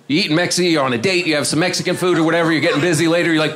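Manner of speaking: normal talking voice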